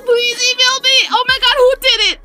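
high-pitched, near-tearful demand; frantic, high and pleading; overwhelmed excitement. Real, not acted. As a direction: cry it out in giddy disbelief. Overwhelmed, demanding who told Wales.

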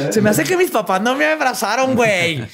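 put-on high ditzy girl voice. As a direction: play a silly girl realizing her mistake. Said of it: "llegó un punto donde dijo". (voz de chava fresa, aguda)

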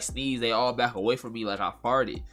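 ordinary talk, nothing marked

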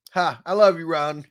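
normal talking voice

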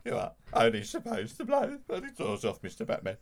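lightly voiced Caine butler; a hint of Caine scolding Batman; Michael Caine as Alfred. Teasing Batman as Caine's Alfred, light impression. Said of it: "if he sounded like that when he was doing the Italian job".

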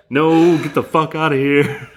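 high voice through laughter; laughing. Pretend. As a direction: laugh while shooing it away. Laughing at horse skull bit.

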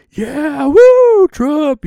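high, loud whooping rally crowd; crowd cheer. Retold or imitated. Crowd hyped at Trump rally.

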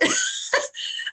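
a high breathy burst of laughter. Real, not acted. Loud laugh sound.

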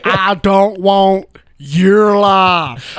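overdone movie line. Retold or imitated. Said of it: "most over-exaggerated lines in history".

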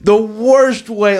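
The worst way slow, high, worst stretched; stress on worst. Real, not acted. Emphatic disgust, slowed.

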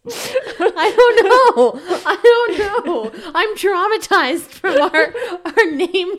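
high, loud laughing, words stretched out. Real, not acted. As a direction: laugh helplessly through it. Laughing over name debates.

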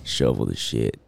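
lightly put-on cowboy drawl. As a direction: talk like a ranch hand. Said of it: "terrible cowboy voice".

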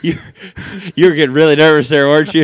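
teasing. Real, not acted. Teasing co-host after cheer.

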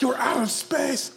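high, loud, slow hammed-up yell; mock movie shout. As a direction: belt it out as an over-the-top warrior. Continuing the shouted movie impression.

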